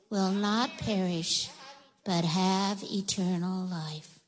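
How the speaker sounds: a hushed, unhurried reading of the verse; slow recitation, eyes shut. Shown as a demonstration. Pastor leading the kids through the verse with eyes closed, slow and soft. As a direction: say John three sixteen slowly and softly, letting every word sit. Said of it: "I want you to shut your eyes".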